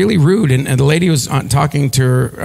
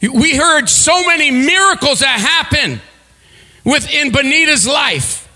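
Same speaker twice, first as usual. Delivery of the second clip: higher, ringing shouted preaching; full-force preaching; 13 semitones higher than usual. Acted. Preacher exhorting congregation to turn aside, full-force preaching. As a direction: drive the point home to the crowd.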